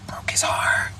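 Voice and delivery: breathy whisper; admitting she hurt him; whispered aside. Real, not acted. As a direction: drop to a secretive whisper. Confessing aside.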